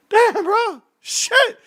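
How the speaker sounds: very high squeaky exclamation; imitated amazed reaction. Soldier watching big guy carry people, high mock squeal. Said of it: "you're like this".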